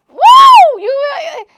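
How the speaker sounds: high, loud joyful whoop; excited whoop. Real, not acted. Podcaster cheering for the ideal woman, loud whoop.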